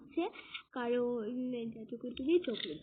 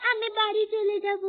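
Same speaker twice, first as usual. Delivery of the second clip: crying; 5 semitones higher, 6 dB louder than usual. Acted. Child wants to go home.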